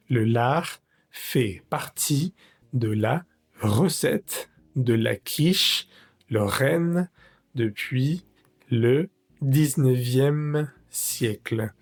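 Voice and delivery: slow, careful reading aloud in French. Shown as a demonstration. French teacher reading the recipe text slowly to students. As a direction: read each word slowly for learners.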